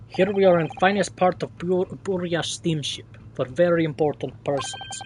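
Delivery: lightly voiced Russian-accented sailor, proud; a hint of a boastful Russian seaman. Sailor showing off the first-class cabins, light Russian accent.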